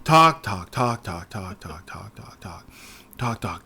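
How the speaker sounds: droning on until it fades to a whisper; bored chant that dies out; fading talk. Retold or imitated. Mocking endless dialogue, fading out.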